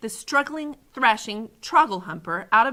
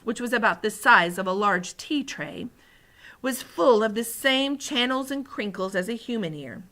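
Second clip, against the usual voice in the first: ordinary delivery, nothing marked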